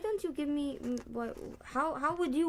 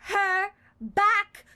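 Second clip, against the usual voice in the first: higher, louder, slow demanding cry; dramatic shout; 10 semitones higher, 9 dB louder, much slower than usual. Acted. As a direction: roar it out as the goddess. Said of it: "Wow, you really got that".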